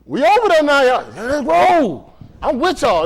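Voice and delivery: high, loud excited whoop; rally the crew to head out; eager rallying. Acted. Preacher joining the congregation's trip to heaven, loud eagerness.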